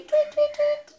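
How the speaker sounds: high, loud, quick tweets; piercing little birdsong for a toddler; bird tweeting. Acted. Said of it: "What does the bird say?".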